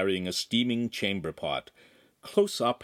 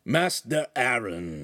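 slow rasping greeting; croaking; 2 semitones higher, slower than usual. Acted. Oaf greeting Aramis, croaking. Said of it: "he croaked".

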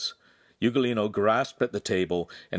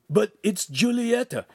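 put-on timid young apprentice; 13 semitones higher, 4 dB louder than usual. A narrator's reading. Ugolino pleads.

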